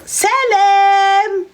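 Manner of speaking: put-on high, bright greeting from the returning girl; girl turning up. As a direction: sing out a sudden hello. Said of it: "et elle vient".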